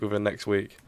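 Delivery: ordinary talk, nothing marked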